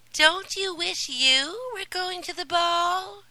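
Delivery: high, loud taunting stepsister voice; mocking stepsister. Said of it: "said one of them".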